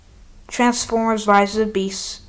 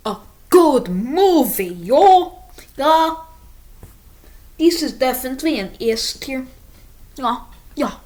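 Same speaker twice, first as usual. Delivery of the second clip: a hint of a hype street accent, a bit louder; put-on accent; 3 semitones higher, 4 dB louder than usual. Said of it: "I don't know why I'm taking this accent".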